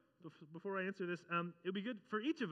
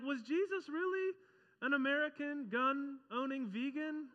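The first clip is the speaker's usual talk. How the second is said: higher, slow, disbelieving question; incredulous; 8 semitones higher, 5 dB louder, slower than usual. Retold or imitated. Churchgoer doubting claims about Jesus, slow and disbelieving. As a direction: ask it as if you can't believe it. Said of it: "you're like".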